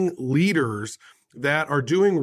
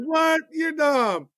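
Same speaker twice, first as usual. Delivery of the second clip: mocking high voice; 11 semitones higher, much slower than usual. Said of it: "They're like".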